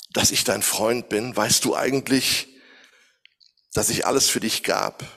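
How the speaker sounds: God tenderly reminding Elijah of his friendship. Acted. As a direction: ask gently like a loving friend.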